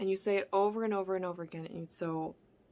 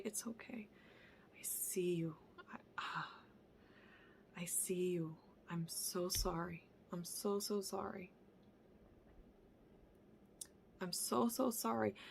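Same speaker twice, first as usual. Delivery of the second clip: soothing parenting voice; 3 semitones lower, 11 dB quieter than usual. Shown as a demonstration. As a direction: hush and soothe your inner child. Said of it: "talk to yourself in this soothing parenting voice".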